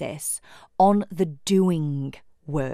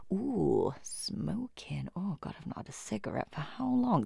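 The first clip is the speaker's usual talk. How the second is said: hushed, tempting inner voice; sneaky craving whisper; 11 dB quieter than usual. Acted. Subconscious creeping in about a cigarette, hushed craving whisper.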